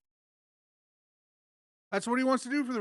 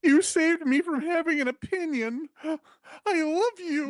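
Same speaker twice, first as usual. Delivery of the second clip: mock gushing fan; 8 semitones higher, 3 dB louder than usual.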